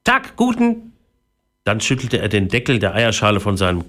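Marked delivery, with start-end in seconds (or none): 0.0-0.9 high, squawky talking parrot. A narrator's reading. Newly hatched parrot greets Philipp, high parrot voice. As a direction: greet like a cartoon parrot, pitched way up. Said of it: "sperrte der Vogel den Schnabel auf und sagte".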